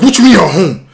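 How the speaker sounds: loud, high re-enacted outburst; outraged disbelief. Phoning a friend who is just at home, outraged shout. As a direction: shout it back in mock outrage.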